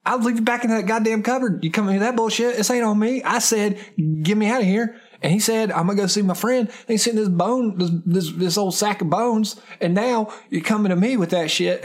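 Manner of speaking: high-pitched, defensive rant; worked-up, high indignant tirade; indignant outburst. Acted. Acted angry outburst at accuser.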